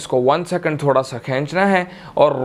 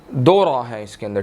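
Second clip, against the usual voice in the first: normal talking voice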